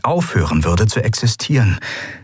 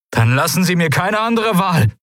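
high, forceful ultimatum; threatening ultimatum; 10 semitones higher than usual. A narrator's reading. Kai gives Sylravan no other choice, threatening. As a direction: warn them with the voice pitched up.

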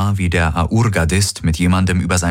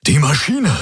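high-pitched excited exclamation; 9 semitones higher than usual. A narrator's reading. Hawks alerts Kirai to the machine, excited. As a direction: burst out with the news, voice jumping up. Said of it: "sagte er aufgeregt".

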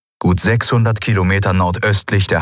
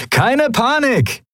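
high, slow cheerful call; good-humoured call to his mates; much slower than usual. A narrator's reading. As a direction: sing it out jovially to calm the crew. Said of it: "in bester Laune zu".

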